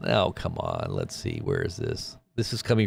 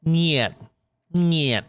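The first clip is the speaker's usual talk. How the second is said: mock Russian niet; 7 semitones higher than usual. Mocking Russian speech.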